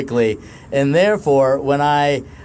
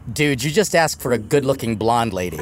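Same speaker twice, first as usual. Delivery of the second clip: waiter's deadpan; 7 semitones lower than usual. Retold or imitated. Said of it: "That's what he said".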